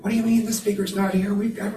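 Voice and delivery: high, quick fretting; mock panic. Meditator imagining everyone's reaction to a missing speaker, mock panicked. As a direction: rush it out high, like a mock flap.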